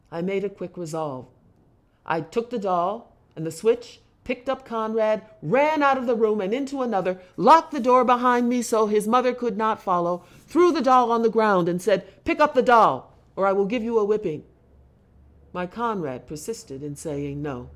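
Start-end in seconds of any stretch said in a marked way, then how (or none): 4.2-12.2 loud, high, driving storytelling; frantic narration. A narrator's reading. Father recounting locking mother out, loud and frantic. 12.2-14.4 loud, high-pitched command; angry threat. A narrator's reading. Father threatening Conrad with whipping, loud angry threat.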